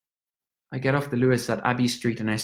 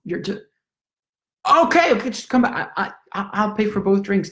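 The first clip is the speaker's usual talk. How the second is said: higher, frantic calls to stop someone; panicked calling out; 6 semitones higher than usual. Acted. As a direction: call out in a panic. Stranger walks off with drinks.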